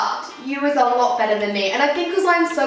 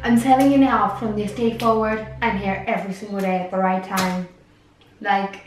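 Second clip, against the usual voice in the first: put-on Cornish accent gone Irish; 5 semitones lower than usual. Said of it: "You've gone very Irish".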